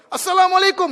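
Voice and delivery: loud, high, ringing greeting; shouted greeting. Retold or imitated. Police chief greeting the idol, loud high shout. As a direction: bellow the salam across the temple. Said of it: "Karena dia teriak keras".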